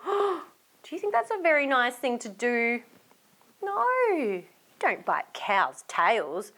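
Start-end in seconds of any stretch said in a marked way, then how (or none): none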